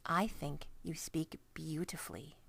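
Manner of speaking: soft whispered praise. A narrator's reading. Sophie whispering kindly to the giant.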